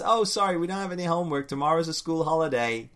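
a hint of a smug kid brushing it off; cheeky Robin. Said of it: "And Robin says".

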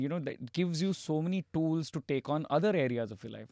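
ordinary talk, nothing marked

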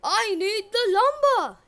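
high, loud brutish bellow; a braggart prince roaring at a girl. Acted. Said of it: "Eric bellowed".

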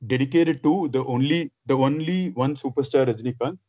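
ordinary talk

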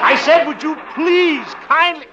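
loud and high, spelling it out. Acted. Repeating again, louder.

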